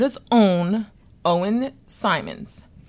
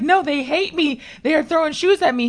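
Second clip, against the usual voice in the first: higher-pitched hurt protest, lightly acted; mock tearful upset; 9 semitones higher, 3 dB louder than usual. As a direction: retell a wounded outburst. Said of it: "and I start crying".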